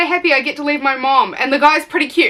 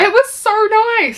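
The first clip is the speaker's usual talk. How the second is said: mock gushing; 10 semitones higher than usual. Mock gushing about car.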